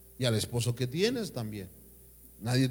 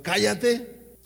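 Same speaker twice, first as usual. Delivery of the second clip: mock angry snap, higher and louder; angry wife; 6 semitones higher, 4 dB louder than usual. Preacher voices an angry wife silencing her husband, mock snap. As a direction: bark a quick shut up at the husband. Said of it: "La mujer enojada y necia le dice al padre".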